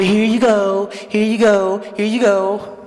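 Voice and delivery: lightly mocking nursery voice; mock spoon-feeding. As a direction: hand out treats to a baby.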